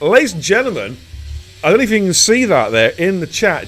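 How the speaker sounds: loud, high hype announcer; excited announcement. Real, not acted. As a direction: announce it big to the crowd. Announces big gift to stream audience.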